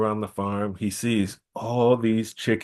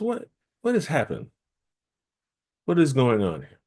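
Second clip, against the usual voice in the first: high concerned stranger; 4 semitones higher than usual. Acted. Eshu in disguise questions Mofani.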